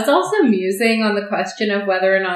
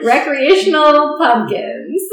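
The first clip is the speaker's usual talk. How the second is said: slow, high and loud, breaking into laughter; amused laughing; 5 semitones higher, 4 dB louder, slower than usual. Real, not acted. Podcaster cracking up over the quote, laughing disbelief. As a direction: draw the words out, tickled by them.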